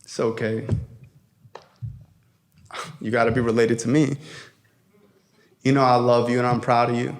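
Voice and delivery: low, gentle father's comfort; quiet reassurance. Retold or imitated. Father forgives his arrested son, quiet reassurance. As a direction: drop low and soothe him.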